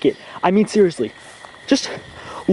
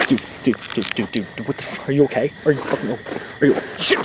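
panicked; 7 semitones lower, 5 dB quieter than usual. Acted. Friend found hurt, panic.

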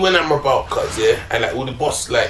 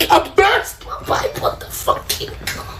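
high, slow, wheezy laughing words; real high-pitched cracking up; laughing hard; 14 semitones higher than usual. Real, not acted. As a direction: squeeze the words out through helpless laughter. Cracking up while telling his story.

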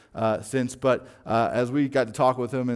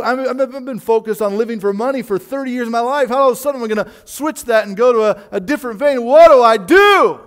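anxious addict voice; 6 semitones higher, 9 dB louder than usual. Retold or imitated. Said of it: "we can be wrapped up and like".

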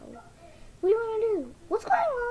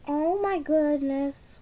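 ordinary talk, nothing marked

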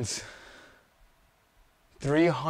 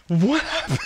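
incredulous repeat; 11 semitones higher, 4 dB louder than usual. Real, not acted. Disbelief after losing run.

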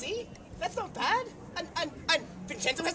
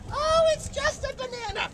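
mocking high voice; 12 semitones higher than usual. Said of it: "They say".

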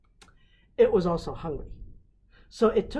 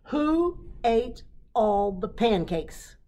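slow, loud accusing yell; 6 semitones higher, 8 dB louder, slower than usual. Acted. Dad confronting Daisy over missing pancakes, slow loud yell. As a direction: demand it slowly and loudly. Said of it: "yelled Dad".

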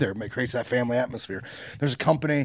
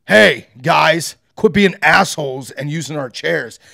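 mic announcement; 9 dB louder, slower than usual. Retold or imitated. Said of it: "the building got on the mic".

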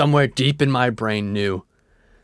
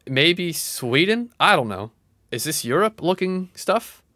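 normal talking voice

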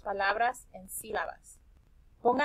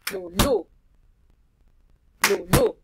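"Lulú" with LÚ much higher and loud; stress on LÚ; 9 semitones higher, 7 dB louder than usual. Shown as a demonstration. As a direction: punch the last syllable of "Lulú". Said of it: "sílaba que se pronuncia más fuerte". (acento fuerte en la sílaba LÚ de "Lulú")

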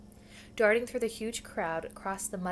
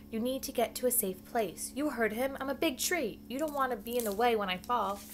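ordinary delivery, nothing marked

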